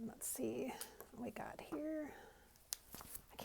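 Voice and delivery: soft unvoiced whisper to herself; hushed private whisper. Real, not acted. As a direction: whisper while peeking at the cards. Peeking at the Ogham cards.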